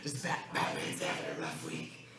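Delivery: grind it out like a wrecked-throat Batman. Pretend. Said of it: "I'll do my Batman".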